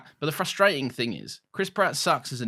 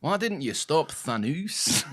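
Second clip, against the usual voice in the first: Scottish Madden impression; 5 semitones higher, slower than usual. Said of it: "big Scottish Richard Madden".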